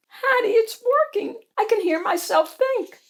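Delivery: high, excited character squeal; delighted Pearl. Acted. Pearl telling Hattie the journal works, high and delighted. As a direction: pipe up thrilled in a tiny voice.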